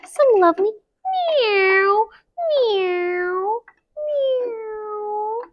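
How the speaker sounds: high sweet mewing; kitten meows. Acted. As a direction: mew like little kittens. Kittens arriving in story.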